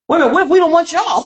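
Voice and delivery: high, fast objection; mock indignant protest. Woman retelling her reaction to being picked, mock indignant.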